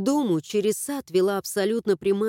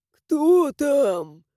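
put-on thin, creaky old voice; creaky old man; 5 semitones higher, 4 dB louder than usual. A narrator's reading. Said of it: "тихий скрипучий голос какого-то старика".

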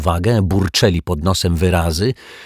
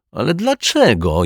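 high-pitched whining protest; plaintive, aggrieved question; moaning; 9 semitones higher than usual.

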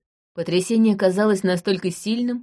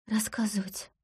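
whisper; 3 semitones higher, 11 dB quieter than usual. Acted. Timid wife barely answers.

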